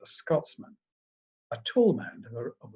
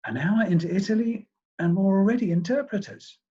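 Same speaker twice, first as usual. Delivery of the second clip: elated; 7 semitones higher, 4 dB louder than usual. A narrator's reading. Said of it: "He was feeling elated".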